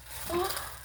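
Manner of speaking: sharp rising gasp of surprise. Real, not acted. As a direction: catch your breath at a sudden find. Finding more crystals.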